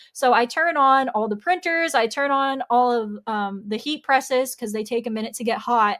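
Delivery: ordinary talk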